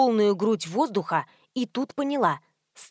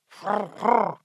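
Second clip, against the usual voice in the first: imitated animal snuffle; donkey snort. A narrator's reading. Donkey snorts behind the heroine.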